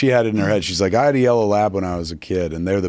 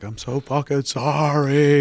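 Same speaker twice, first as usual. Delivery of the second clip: slow, higher, pained sympathy; heartfelt apology; 7 semitones higher, slower than usual. Retold or imitated. Doctor apologizing to a pet owner with slow, pained sympathy. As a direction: pour out a sorry like a grieving doctor.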